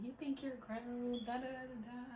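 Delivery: lightly mocking parental lecture; imitated scolding mom. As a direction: drag it out like a nagging mother.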